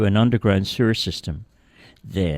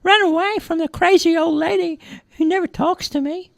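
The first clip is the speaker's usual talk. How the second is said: very high, squeaky kitten; high little cat voice, a bit quick. Acted. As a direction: talk like a tiny runaway kitten. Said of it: "said the kitten".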